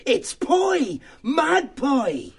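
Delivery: high, eager little mouse; a bright little church mouse working it out. Acted. Church mouse voiced by narrator.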